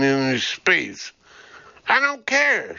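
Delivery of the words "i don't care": high, sharp shout; a sudden high cry of defiance. A narrator's reading. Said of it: "she shouted".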